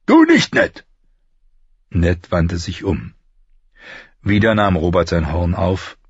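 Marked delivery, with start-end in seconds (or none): 0.1-0.8 loud, high shout after him; called-out command. A narrator's reading. King stopping Ned as he leaves, shouted. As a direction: call him back at full voice. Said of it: "als der König rief".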